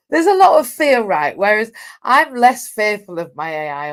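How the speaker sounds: usual speaking voice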